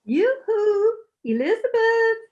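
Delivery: high, loud singsong call. Acted. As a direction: trill it out to someone in another room. Visiting mother-in-law calls out for Elizabeth.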